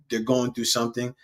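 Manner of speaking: ordinary talk, nothing marked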